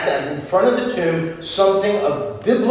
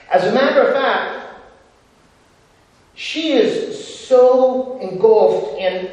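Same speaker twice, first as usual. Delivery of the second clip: usual speaking voice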